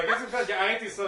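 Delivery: laughing. Real, not acted. Réagit en riant aux accents.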